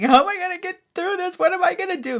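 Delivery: high, fast, panicky inner voice; panicked thought. Retold or imitated. Reliving the panic on the toilet, high and frantic. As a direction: rush it out in rising panic.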